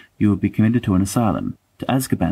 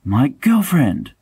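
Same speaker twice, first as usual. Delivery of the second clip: lovestruck James; 2 semitones higher than usual. Retold or imitated. Mocking James as he brags to friends about his girlfriend, rising mock gush. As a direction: crow it out like a smitten schoolboy. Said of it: "and James would say".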